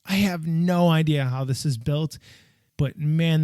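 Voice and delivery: ordinary delivery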